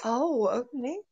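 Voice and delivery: ordinary talk, nothing marked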